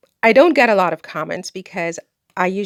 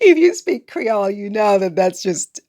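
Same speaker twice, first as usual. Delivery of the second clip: laughing. Real, not acted. Cracking up at her own Creole reply, laughing.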